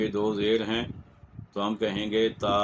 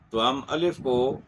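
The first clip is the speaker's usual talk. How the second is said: ordinary talk, nothing marked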